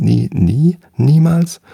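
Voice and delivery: rising high in disbelief; incredulous. Acted. Dylia pressing the gnome about never sleeping, rising disbelief.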